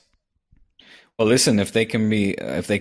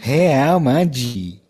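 put-on Portuguese accent; 5 semitones higher than usual. Portuguese-accent Spanish commentary impression.

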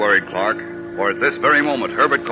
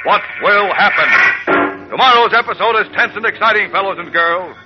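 high, booming cliffhanger announcer; old radio announcer; 8 semitones higher, 4 dB louder than usual. Acted. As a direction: hype tomorrow's episode, pitch raised. Cliffhanger outro.

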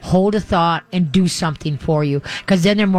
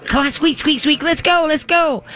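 much higher cheering for a dog that balks; cheerleader coaxing; 9 semitones higher than usual. Shown as a demonstration. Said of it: "if she slams out".

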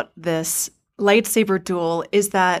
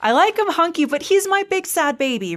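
gushing fan; 9 semitones higher than usual. Real, not acted. Gushing fan over beloved character.

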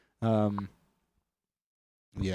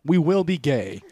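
announcer voice; 6 semitones higher, 7 dB louder than usual. Acted. Mock show announcement.

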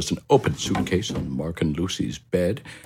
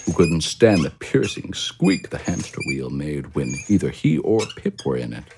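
usual speaking voice

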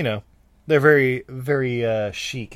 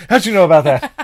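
laughing; 5 semitones higher, 12 dB louder, faster than usual. Real, not acted. Laughing at Grindr joke.

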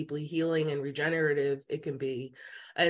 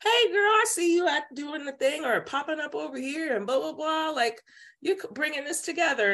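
much higher, bubbly friend voice; friendly hey girl; 9 semitones higher than usual. Retold or imitated. Distant friends noticing her new path, in a high bubbly voice. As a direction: gush like an acquaintance catching up. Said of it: "And they're like".

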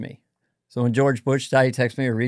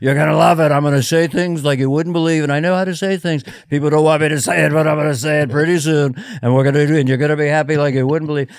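loud, fast, boastful Trump voice; Trump impression; 4 dB louder, faster than usual. Comic showing how easy Trump is, loud and boastful. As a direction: brag it like Trump. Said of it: "Trump is so easy".

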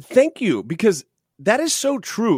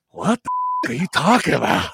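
Pacino voice. Retold or imitated. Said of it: "Pacino goes".

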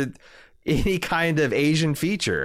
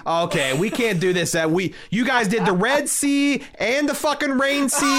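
high, outraged studio exec yelping about the budget; shrill budget panic, voice climbing; panicked producer; 8 semitones higher than usual. Acted. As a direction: play a frantic money man, pitch way up.

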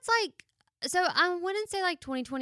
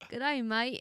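light attempt at Aussie accent; Australian accent. Shown as a demonstration. Friend asks her for an accent, amateur Australian accent. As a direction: try an Australian greeting. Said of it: "Do Australian?".

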